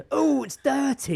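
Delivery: put-on sing-song mum telling a toddler off; hammed-up neighbour mother; parent scolding child. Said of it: "someone over the fence go".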